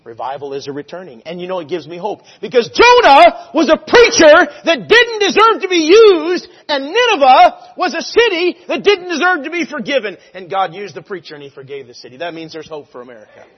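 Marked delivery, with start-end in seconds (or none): 2.4-10.2 high, loud, slow preacher's cry; full-force preaching. Real, not acted. Preacher stirring the congregation, loud and high. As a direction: preach it out to the whole church.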